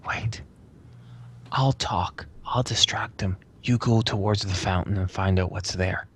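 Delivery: hushed in-character stage whisper; secretive, breath-only whisper; very quiet whisper. Acted. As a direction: whisper the plan to companions. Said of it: "kinda just whispers very, very quietly".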